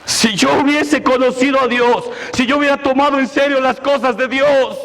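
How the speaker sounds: urgent preacher, pitch up and pushing hard; impassioned preaching. Acted. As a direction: preach it like a lost soul is on you. Preacher builds to full force.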